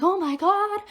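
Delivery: high, loud mock panic; imitated mom freaking out. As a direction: play a mother alarmed on the phone. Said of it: "My mom starts freaking out".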